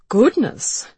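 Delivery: light, bright exclamation; cheerfully smooth it over. Acted. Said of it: "said Mister Tilden brightly".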